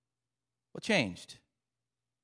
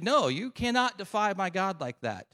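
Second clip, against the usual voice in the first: raised-pitch protest; indignant defiance; 7 semitones higher than usual. Acted. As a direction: stand up to the bully. Acted David defying the giant.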